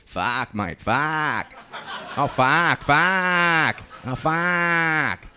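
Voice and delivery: put-on drawn-out crow caws in an Aussie accent. Aussie pub fans watching a sports final, cawing like crows. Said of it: "they sound like crows".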